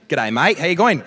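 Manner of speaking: loud, lilting Australian greeting; Aussie accent. Retold or imitated. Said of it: "they hear an Aussie accent".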